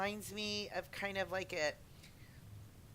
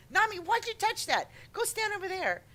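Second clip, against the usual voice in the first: higher, louder nagging teammate; bossy teammate; 11 semitones higher, 5 dB louder than usual. Retold or imitated. Imitating a teammate scolding Nami in game, lightly bossy. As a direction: boss Nami around, mock-annoyed. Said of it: "I could just see you saying to me".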